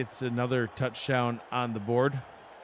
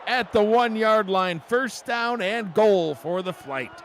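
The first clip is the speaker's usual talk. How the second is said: loud and high, easing down slowly; excited play call; 7 semitones higher, 5 dB louder, slower than usual. Real, not acted. Announcer placing the ball after a big catch, excited. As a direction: still fired up, settling as the play ends.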